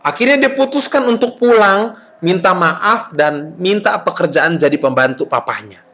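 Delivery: ordinary delivery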